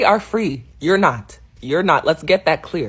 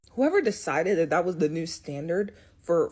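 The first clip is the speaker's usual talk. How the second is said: normal talking voice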